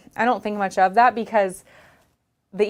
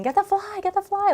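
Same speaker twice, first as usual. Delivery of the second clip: screaming mom; 13 semitones higher than usual. Retold or imitated. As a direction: imitate mom shrieking at half volume. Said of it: "I could hear my mom, like, screaming".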